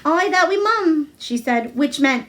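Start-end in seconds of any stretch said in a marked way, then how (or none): 0.0-1.1 put-on higher, a girl trying out Yorkshire; a touch of learned Yorkshire dialect; broad Yorkshire. A narrator's reading. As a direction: test the dialect proudly. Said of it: "So she spoke a little now".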